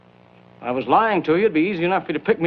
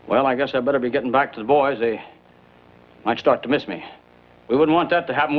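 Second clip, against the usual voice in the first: ordinary delivery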